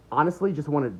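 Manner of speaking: usual speaking voice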